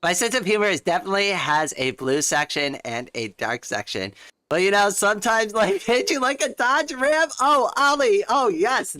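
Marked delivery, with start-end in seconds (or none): 4.5-9.0 high, loud delighted squeal. Real, not acted. Streamer thrilled by a chat joke, high gleeful squeal. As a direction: gush with glee at the joke.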